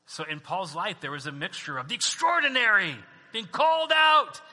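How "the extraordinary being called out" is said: loud, high preacher's cry; grand proclamation. Real, not acted. Pastor proclaiming Paul's extraordinary calling, grand and loud. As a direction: ring it out, stretching the big words.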